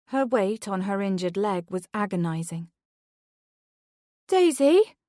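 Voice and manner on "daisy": high, loud call into the dark; mother calling for her lost daughter in the dark. A narrator's reading. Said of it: "she called out into the dark, weakly".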